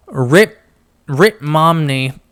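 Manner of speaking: a hint of British accent, half by accident. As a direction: toss off the name in a mock British accent. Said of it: "I gained a British accent then".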